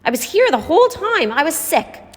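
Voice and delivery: frightened plea. A narrator's reading. Marcy defending herself to Lonnie, high and frightened. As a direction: plead to calm him down.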